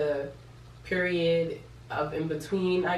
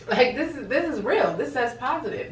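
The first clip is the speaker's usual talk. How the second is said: high, wavering, emphatic protest; loud mock-frantic disbelief; worked-up insistence; 7 semitones higher, 4 dB louder than usual. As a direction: insist it's real, voice swooping up. Retold protest to boyfriend, in shock.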